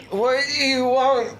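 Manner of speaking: very high, drawn-out wail; pained cry. Acted. Fallen gutter cleaner to his visitors, pained wail.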